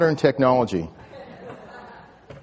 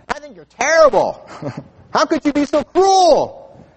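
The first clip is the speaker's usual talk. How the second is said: high, louder outraged child imitation; mock kids' yell; 9 semitones higher, 4 dB louder than usual. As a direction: scold like a hammed-up, appalled kid. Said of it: "they would yell at us".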